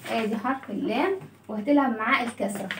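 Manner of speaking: normal talking voice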